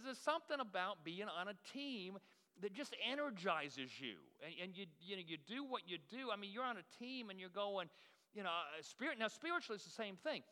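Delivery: normal talking voice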